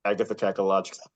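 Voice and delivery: quick, low rattle of a tongue-twister word; "Agatha Cacalogical" rushed out quietly; quick run-through. Real, not acted. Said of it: "Can you say it slowly".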